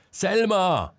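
high alarmed shout across the yard; frantic shout for Selma; shouting a name. A narrator's reading. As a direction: yell her name in panic. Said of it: "schrie Marcel erneut".

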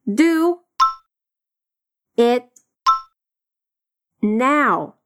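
Do, it, now each alone with pauses; slow separated drill; word by word. Shown as a demonstration. Teacher drilling Do it now word by word.

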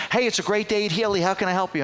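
high, quick phone greeting; chirpy receptionist. Retold or imitated. Receptionist answering a car dealership's phone, chirpy. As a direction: imitate an over-cheery receptionist.

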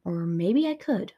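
plain reading voice

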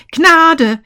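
loud, high desperate plea; crying out for mercy. A narrator's reading. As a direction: throw yourself on his mercy, loud. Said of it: "rief er".

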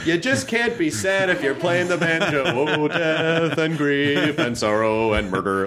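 playful banjo-bit delivery, slowing into drawn-out doom. Retold or imitated. Retelling an old comedy bit about banjo music, mock-cheerful comic routine. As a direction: put on the banjo comic, stretching out the gloomy words. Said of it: "it's like the old [name] bit".

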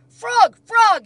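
high, very loud call; Toad's excited cry. A narrator's reading. Toad runs to Frog's house with news, crying out. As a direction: cry out to a friend by name. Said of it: "he cried".

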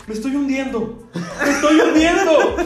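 high, loud mock panic, slowing on the repeat; panicked mother. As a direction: mom melting into the seat in terror. Mom sinking into seat, panicking.

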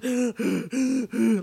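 loud imitated gasp between sobs; sobbing gasp. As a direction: gulp air like a hard cry. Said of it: "like, I'm bawling".

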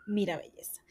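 soft breathy whisper; whispered aside. Real, not acted. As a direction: lean in and whisper it. Confiding aside to listener.

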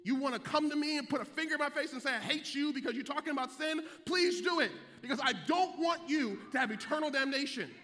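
loud, rising preacher's call; shouting the congregation into the gospel; preaching at full force. Acted. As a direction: whoop it up like a Sunday sermon climax.

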